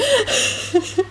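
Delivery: high burst of helpless laughter; high-pitched fit of giggles. Real, not acted. Reader cracks up.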